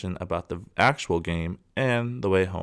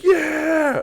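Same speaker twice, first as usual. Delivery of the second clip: high, loud whoop; mock cheer; 5 dB louder than usual. Crowd cheering as the sign goes down, high whoop.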